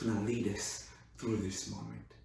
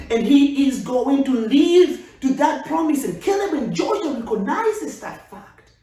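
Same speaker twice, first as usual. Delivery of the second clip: high, loud preaching build; loud high preacher's cry; full-force preaching; 13 semitones higher, 10 dB louder than usual. Acted. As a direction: preach it out with fire.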